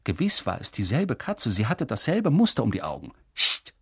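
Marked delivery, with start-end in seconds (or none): 3.3-3.7 loud hiss. Acted. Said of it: "zischte Mister Dursley laut".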